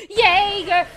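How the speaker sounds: battle-cry shout. Pretend. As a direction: yell the name out full force. Shouting Attack on Titan name.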